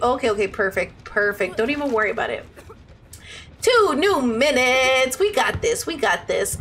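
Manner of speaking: ordinary talk, nothing marked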